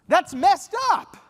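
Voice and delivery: high, loud, slow blurted protest; angry outburst. Retold or imitated. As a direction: explode at the unfairness. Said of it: "an outburst like that".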